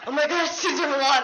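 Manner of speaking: retelling meeting a famous man, excited; starstruck gush. Retold or imitated. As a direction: gush like a fan meeting a celebrity. Retells her starstruck reaction.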